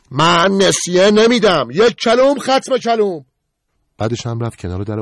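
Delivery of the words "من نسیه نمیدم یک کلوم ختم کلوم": slow, high, loud shout. Acted. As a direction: shout each word, final. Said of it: "قربان داد زد". (فریاد کشیده و بلند)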